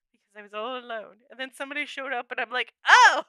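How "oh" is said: loud, high startled oh; surprised. Retold or imitated. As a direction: caught off guard. Someone showed up mid-song.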